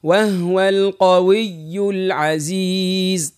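slow وهو القوي العزيز with both shaddahs pressed; slow model recitation for the student; model reading. Shown as a demonstration. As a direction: lay out each shaddah slowly. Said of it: "ترعودها".

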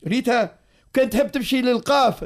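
ordinary talk

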